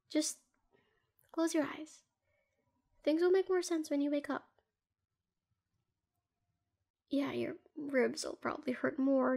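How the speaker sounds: hushed and tender, lulling someone to sleep; soft murmur to a hurt partner at bedside; soft soothing whisper. Acted.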